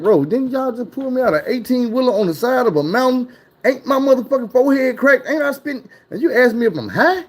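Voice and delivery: pitched well up, arguing back at the cop; rising disbelief, voice climbing high. Retold or imitated. Retelling the cop's question, high incredulous protest. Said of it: "I'm like".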